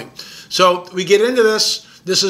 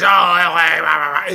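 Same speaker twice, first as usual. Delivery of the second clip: mocking naysayers. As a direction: brush them off in a sneering put-on voice.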